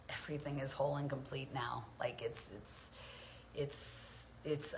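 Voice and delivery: hushed, breathy awe; whispering how whole it feels; reverent whisper. Real, not acted. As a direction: let it fall to a near whisper. Awed whisper about wholeness.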